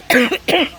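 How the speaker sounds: one sudden hard cough; real cough from the thin air. Real, not acted. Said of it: "Sorry about that".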